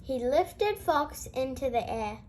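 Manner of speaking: usual speaking voice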